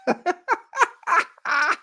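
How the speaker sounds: high-pitched burst of laughter; real high laugh. Real, not acted. Teller cracking up at his neighbor's remark, high helpless laugh. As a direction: crack up in high, rapid cackles.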